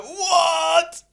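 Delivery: high, loud shocked cry; a loud high yelp of disbelief; shocked what. Retold or imitated. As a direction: blurt it out in total disbelief. Stunned at sumo wrestling basement.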